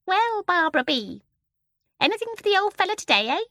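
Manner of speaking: high, fast coaxing bird voice; wheedling starling. A narrator's reading. The starling coaxing Barbara for a biscuit, wheedling. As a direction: sweet-talk her for a treat. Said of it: "in a soft, wheedling voice".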